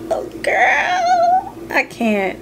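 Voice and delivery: high squeal into laughter; can't get the words out for laughing; real giggle breaking through. Real, not acted. Breaks off laughing mid-sentence.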